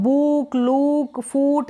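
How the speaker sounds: बुक, लुक, फूट with stretched oo; long oo in बुक, लुक, फूट. Shown as a demonstration. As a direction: draw out each vowel in बुक, लुक, फूट. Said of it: "इस तरीके से भी पढ़ेंगे".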